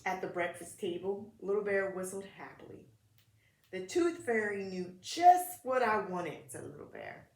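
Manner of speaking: put-on gentle read-aloud for kids; children's story narration; storybook reading. A narrator's reading. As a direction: read it like a picture book at story time.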